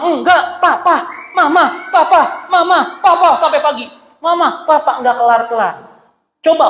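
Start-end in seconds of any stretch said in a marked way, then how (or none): 0.0-5.0 mock bickering. Acted endless parent quarrel.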